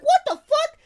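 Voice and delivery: high, loud, incredulous shriek of excitement; amazed outburst. Acted. Fan finding out a star fed his cat, amazed outburst. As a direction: blurt it out high-pitched and thrilled.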